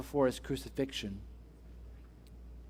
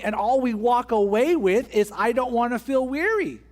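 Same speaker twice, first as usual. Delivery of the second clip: building preacher's fervor; 6 semitones higher, 4 dB louder than usual. Acted. Preaching climax, rising intensity.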